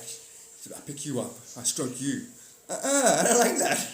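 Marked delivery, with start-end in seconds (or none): 2.7-3.8 high, loud, laughing cat protest; the cat refuses to be stroked, high and laughing; fussy cat voice. Acted. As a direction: squeal it like an offended kitty. Cat voiced in silly story.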